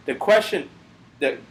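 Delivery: halting, tripping over the words; real stumbling. Real, not acted. Host fumbling the story to co-hosts, real stuttering stumble. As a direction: lose your way mid-sentence and restart. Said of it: "you are stuttering even more than usual".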